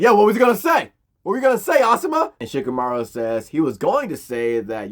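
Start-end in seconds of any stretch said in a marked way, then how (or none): 0.0-2.3 loud, high-pitched demand; hammed-up indignant yelling; mock outrage. Choji demanding what his sensei was gonna say, mock outrage. Choji confronts Asuma over insult.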